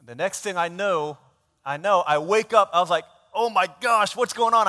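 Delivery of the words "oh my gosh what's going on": higher, louder, startled outcry; retold alarm at oversleeping; panicked waking. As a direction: blurt it out as if just jolted awake.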